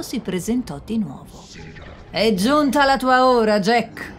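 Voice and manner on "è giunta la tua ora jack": louder, higher menacing pronouncement; more menacing devil. Acted. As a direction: announce his doom with menace. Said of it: "la sua voce ancora più minacciosa". (il diavolo minaccioso, più forte)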